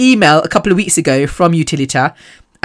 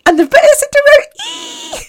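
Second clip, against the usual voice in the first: sudden high shriek of delight; thrilled shriek. Real, not acted. Missy screams at the concert ticket upgrade, high and thrilled. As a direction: scream it out with joy.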